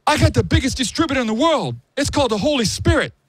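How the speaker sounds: high, strange voice for the holy line; Stephen Baldwin preaching. Retold or imitated. Retelling what Stephen Baldwin said, high mock voice. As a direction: squeak out the big reveal. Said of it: "This is what he said".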